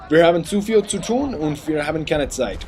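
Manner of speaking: lightly hammed German with a put-on Mongolian tint; German with a mock Mongolian accent. Gamer showing off his German to viewers, mock Mongolian accent. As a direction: say the German like a mock Genghis Khan. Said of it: "I adopt this sadistic Mongolian accent when I speak German".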